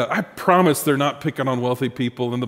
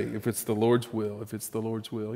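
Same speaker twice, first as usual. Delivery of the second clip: soft, fading; 5 semitones lower, 9 dB quieter than usual. Shown as a demonstration. Preacher voicing the humble prayer, fading soft. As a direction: drop softer on each. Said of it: "You ought to say".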